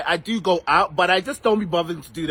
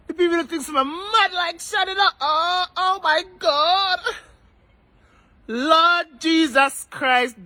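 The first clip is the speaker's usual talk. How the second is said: mock frantic; 8 semitones higher than usual.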